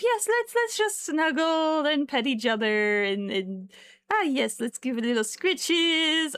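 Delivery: lightly voiced pet, loud and slow; mock cat voice. Owner voicing her cat in mock innocence. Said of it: "you know the pain of".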